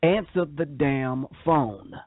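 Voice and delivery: every word of "Answer the damn phone" laid down slowly and firmly; slow emphatic command. Acted. Preaching, driving the point home.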